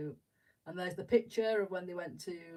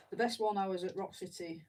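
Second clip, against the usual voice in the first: ordinary talk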